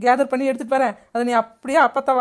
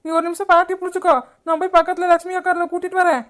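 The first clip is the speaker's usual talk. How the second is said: high, urgent cries of alarm; panicked; 5 semitones higher, 3 dB louder than usual. Acted. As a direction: raise the alarm as someone collapses. Grandmother collapses, women panic. (பதறிப்போய் கத்தும் குரல்)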